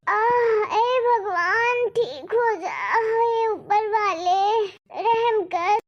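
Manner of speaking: slow, sobbing plea to God; crying prayer. Acted. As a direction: beg for mercy through the pain. Mother-in-law praying through pain. (धीमी, रोती हुई भगवान से गुहार)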